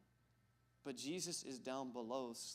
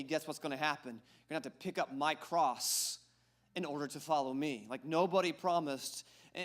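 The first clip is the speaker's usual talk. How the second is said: louder preaching at full force; 2 semitones higher, 9 dB louder than usual. Acted. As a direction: drive the point home to the congregation.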